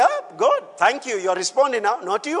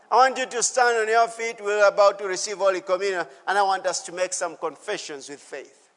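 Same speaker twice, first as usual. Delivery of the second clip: usual speaking voice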